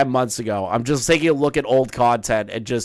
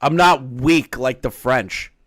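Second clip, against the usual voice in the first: usual speaking voice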